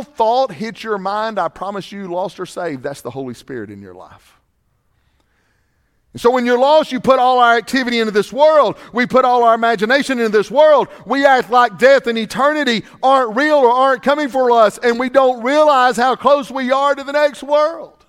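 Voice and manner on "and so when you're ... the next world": louder, higher preaching, slowing at the end. Acted. Preacher telling congregation they ignore death, preaching loudly.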